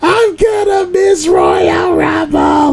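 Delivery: high, slow wailing shout; retold kid shout. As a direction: scream it like a kid losing it. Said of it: "I go".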